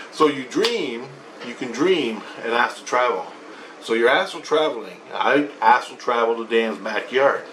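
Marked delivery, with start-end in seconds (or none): none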